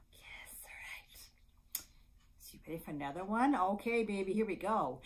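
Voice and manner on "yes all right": very soft whisper; whispered aside. Real, not acted. Librarian to baby after tummy game, soft whisper. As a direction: whisper a little approval.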